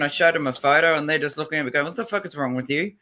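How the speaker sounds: normal talking voice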